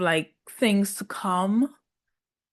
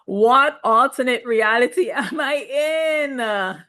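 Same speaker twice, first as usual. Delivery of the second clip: incredulous; 3 semitones higher than usual. Acted. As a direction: react as if utterly bewildered. Voices own usual incredulous reaction.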